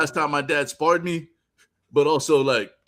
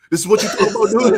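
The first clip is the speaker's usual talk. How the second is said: louder, slightly higher taunting dad; mocking old dad; 6 semitones higher, 7 dB louder than usual. Father mocking his son, a louder higher taunt. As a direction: push the taunt further. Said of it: "with the old man strength".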